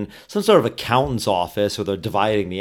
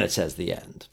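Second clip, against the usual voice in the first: hushed whisper, unvoiced; 4 semitones lower, 10 dB quieter than usual. Real, not acted. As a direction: whisper it, no voice at all. Whispered ending aside.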